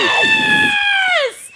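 real high, drawn-out shout of joy; excited cheer. Real, not acted. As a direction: let out a long, squealed cheer. Cheers that the game will return.

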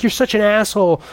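high, hammed-up insult; mock exasperation. Ali Sheedy's character insulting him as he drags her off, high mock scorn. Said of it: "And Ali Sheedy's like".

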